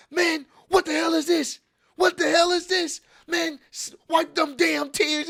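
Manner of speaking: high, strained mock yell of a fuming rival; hammed-up seething shout; furious rant. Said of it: "pissed the fuck off".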